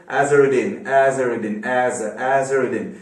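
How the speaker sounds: imitated English commentator saying AZ-er-a-din; English pronunciation. Teacher mimicking English commentators on a cricketer's name, English accent. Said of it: "अंग्रेज pronounce करते थे उसको".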